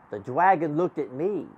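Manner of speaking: plain reading voice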